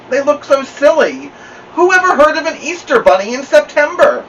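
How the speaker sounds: high, whiny complaint; complaining. A narrator's reading. Beezus complaining to Mother about Ramona's ears. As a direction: complain that it looks silly. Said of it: "Beezus complained".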